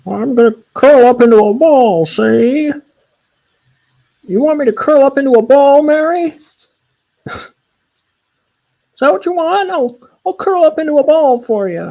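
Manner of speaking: a cartoon armadillo squeaking at Mary. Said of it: "Here's my impression of an armadillo".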